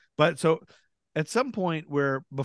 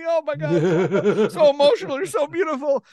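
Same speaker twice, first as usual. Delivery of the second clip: fake teary gushing; 11 semitones higher, 6 dB louder than usual. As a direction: pretend to be moved to tears. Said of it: "I try to play it off like I'm crying".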